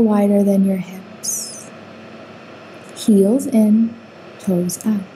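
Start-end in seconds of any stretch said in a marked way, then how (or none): none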